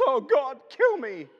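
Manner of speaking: high, loud mock wail; despairing Elijah. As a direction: ham up a self-pitying prophet. Elijah despairing under a bush.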